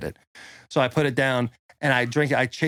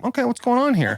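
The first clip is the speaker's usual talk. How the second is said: high-pitched, curious mock surprise; acting out a wary but curious reaction; retold intrigued reaction; 11 semitones higher than usual. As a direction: lift the voice high, playfully intrigued. His own intrigued reaction, retold.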